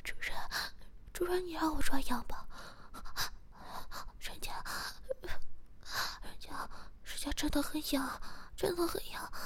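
high tearful whispered pleading; tearful whisper, pitched well up; crying plea. Acted. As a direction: whimper the begging up high. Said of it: "诗雅哭着说".

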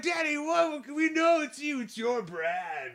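high, loud teasing squeal; teasing classmates. Retold or imitated. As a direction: accuse him in a shrill mock voice. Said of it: "They're like".

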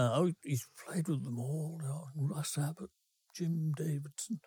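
mock gin-soaked cooing, fading; tipsy landlady again. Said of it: "trying not to do what you've just done".

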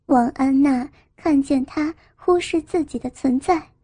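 usual speaking voice

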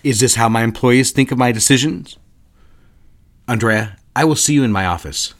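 loud, cold reprimand; a boss scolding his secretary, loud and stern; stern rebuke. Acted. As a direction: put them in their place. Boss scolding employees, cold authority.

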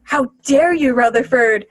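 hammed-up department head gone sentimental; mock outraged boss. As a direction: play the boss fake-angry then gushing. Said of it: "the guy was like".

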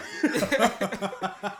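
big burst of embarrassed laughter; loud laugh at his own bad accent. Real, not acted.

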